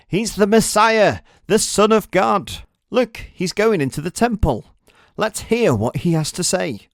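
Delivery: high, loud and fervent; eager believer. Acted. A devoted follower urging the crowd into the temple, fervent and loud. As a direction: urge everyone to follow him in.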